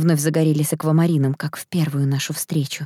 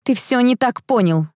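high, insistent pleading; raised-pitch anxious explanation; urgent protest; 7 semitones higher than usual. A narrator's reading. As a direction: protest quickly in alarm. Panicked heroine protests to prince.

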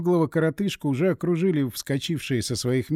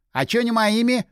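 indignant protest, pitched up; 8 semitones higher, 4 dB louder than usual. A narrator's reading. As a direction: object in a higher, affronted voice. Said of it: "возмутился Напалм".

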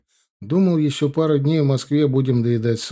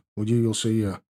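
plain reading voice

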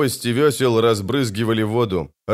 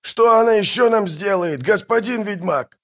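high, alarmed outburst; crying out; 11 semitones higher than usual. A narrator's reading. Captain to witcher about the fox's spell, crying out. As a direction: demand an answer in a high cry. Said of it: "закричал Пудлорак".

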